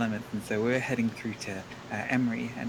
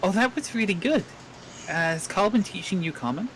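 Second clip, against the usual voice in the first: high, louder friendly call to the guard; [name] calling in Goblin; 8 semitones higher, 4 dB louder than usual. Acted. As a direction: praise him like an eager teacher. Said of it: "call out in Goblin to Bork, going like".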